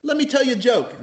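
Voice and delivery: high-pitched quoted voice; Ace. Retold or imitated. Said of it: "He goes".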